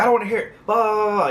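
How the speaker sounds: high-pitched retold telling-off; mock yelling. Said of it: "started yelling at me".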